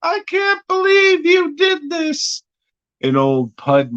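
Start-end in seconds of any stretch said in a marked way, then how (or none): 0.0-2.4 high, shrill falsetto panic; freaking out. Retold or imitated. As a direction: squeal it in a hammed-up panic after being cut. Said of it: "Meatloaf freaks out".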